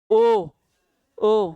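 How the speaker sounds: "ओ" held as a lone sound; isolated, sustained "ओ" for the class; vowel pronunciation demo. Shown as a demonstration. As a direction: sound out "ओ" by itself, pausing between repeats. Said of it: "आप पढ़िए, ये अकेले आ जाता".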